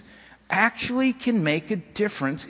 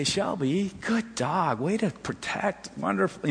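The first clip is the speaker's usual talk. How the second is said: softer, calm praise for the dog; calm and soothing; 3 semitones higher, 6 dB quieter, slower than usual. Shown as a demonstration. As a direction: settle the dog down gently. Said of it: "very calmly".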